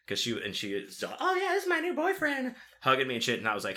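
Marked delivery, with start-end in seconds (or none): 1.2-2.6 very high, mock-girlish gushing; put-on high-pitched girlfriend voice; mimicked woman's voice. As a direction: imitate a woman playing along, way up high. Mimics a woman claiming him as boyfriend.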